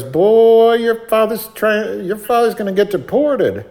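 high, drawn-out mocking cashier voice; brash cashier. Cashier teasing the little boy, brash high-pitched voice. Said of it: "And she goes".

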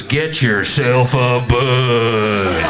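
low, loud, very drawn-out announcer; booming slow-motion ad voice; commercial voiceover. Retold or imitated. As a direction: stretch the slogan out like a beer ad. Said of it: "And the voiceover goes".

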